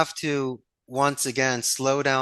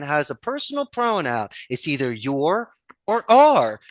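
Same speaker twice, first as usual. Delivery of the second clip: usual speaking voice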